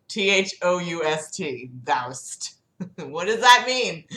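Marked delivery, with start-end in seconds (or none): none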